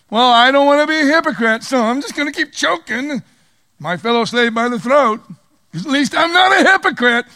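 high, loud mock of a smug hypocrite; mocking self-righteous voice. Preacher mocking the unforgiving as a smug hypocrite. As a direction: sneer it out like a proud pharisee.

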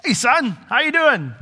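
high, jolly dad greeting; friendly father. Pretend. The Father welcomes Jesus, jolly dad voice. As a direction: greet your son warmly.

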